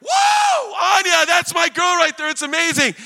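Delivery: very high, loud whoop of delight; proud cheering shout. Retold or imitated. Dad cheering his daughter's big play, re-enacted excited shout. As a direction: holler like a parent at the game.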